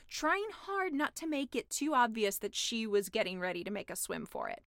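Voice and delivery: usual speaking voice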